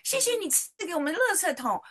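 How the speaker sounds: put-on 谢谢 high and childlike, 垃 stressed with a falling tune; a little girl thanking Jesus for the trash can; child praying. Little daughter thanking Jesus in Sunday school, high childlike voice. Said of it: "他就祷告说".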